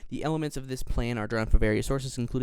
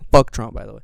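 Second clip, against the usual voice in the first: ordinary delivery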